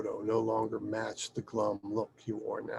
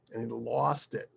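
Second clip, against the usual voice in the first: ordinary delivery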